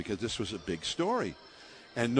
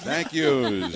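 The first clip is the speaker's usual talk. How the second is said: Brooklyn announcer; 6 dB louder than usual. Retold or imitated. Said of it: "Tex says".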